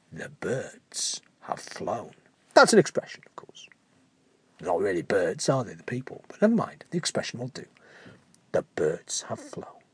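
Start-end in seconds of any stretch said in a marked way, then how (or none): 0.1-2.1 much lower, softer and slow; dramatic hush. A narrator's reading. Dramatic reveal. 8.5-9.8 lower, whispered; whispered reveal. A narrator's reading. Repeat reveal.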